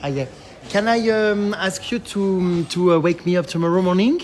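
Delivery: hesitant English with a French accent; real French accent, halting English. Real, not acted. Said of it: "écoutez mon accent anglais".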